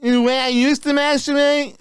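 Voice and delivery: put-on high, slurred drunk guy voice. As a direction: sound wasted and sloppy. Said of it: "that drunk voice is pretty spot on for you".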